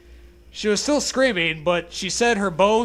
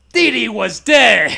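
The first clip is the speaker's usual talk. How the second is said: Dexter voice; 3 semitones higher, 8 dB louder, slower than usual. Acted. Dexter wailing to parents.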